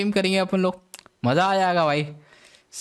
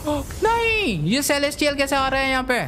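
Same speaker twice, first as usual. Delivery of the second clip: high, startled cry of disbelief; shocked dismay; 8 semitones higher than usual. Real, not acted. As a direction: gasp it out in shock. Shocked at Celestial arriving.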